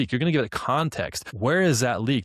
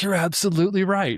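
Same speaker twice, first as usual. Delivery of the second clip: mock chatbot voice, pitched higher; sycophantic AI catchphrase; 9 semitones higher, 3 dB louder than usual. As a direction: gush the stock agreement like Claude. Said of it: "it's always saying".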